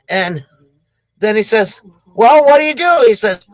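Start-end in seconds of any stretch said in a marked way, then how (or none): 2.1-3.0 urgent question. Acted. Bitten man asks urgently.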